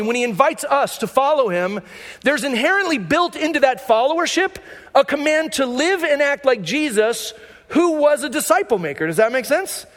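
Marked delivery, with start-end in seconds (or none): none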